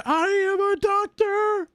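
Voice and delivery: very high-pitched strained screech; hammed-up shrieking proclamation. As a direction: screech it out as a meme outburst.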